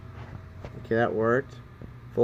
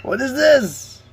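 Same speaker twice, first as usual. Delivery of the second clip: surprised; 11 semitones higher, 5 dB louder, slower than usual. Real, not acted. Sudden reaction to chat.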